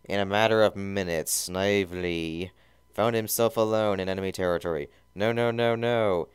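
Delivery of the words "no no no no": mild mock whimper. A narrator's reading. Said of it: "he whimpered".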